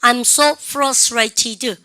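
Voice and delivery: each word drawn out for students to repeat; slow, careful classroom demo sentence; slow model reading. Shown as a demonstration. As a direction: read it very slowly and clearly, like a teacher's model. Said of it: "시작".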